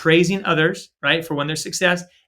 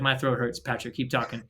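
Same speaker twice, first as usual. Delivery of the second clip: quiet, strained mock complaint about a sore throat; mock hoarse plea; 5 semitones lower, 9 dB quieter than usual. Teasing a friend, imitating him, much softer and strained. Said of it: "Ken's like".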